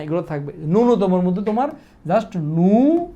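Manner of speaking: normal talking voice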